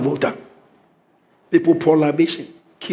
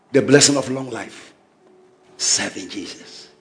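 ordinary delivery

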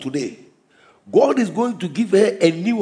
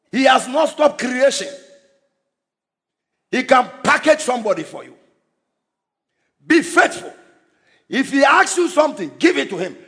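preaching at full force; 6 semitones higher than usual. Acted. Preacher driving the point of sacrifice home to the congregation, full-force preaching. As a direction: preach it hard, driving each phrase home.

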